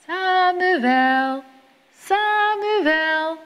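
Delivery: high, drawn-out calling voice from afar; God calling. A narrator's reading. God calls sleeping Samuel in the night, distant and sung. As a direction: call the boy's name like a distant sung summons. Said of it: "hoort hij weer".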